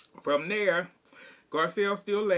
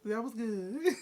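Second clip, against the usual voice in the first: mock quote; 4 semitones higher, 3 dB quieter than usual. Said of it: "Lisa went".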